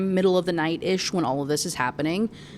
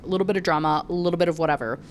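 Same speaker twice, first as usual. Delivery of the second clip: plain reading voice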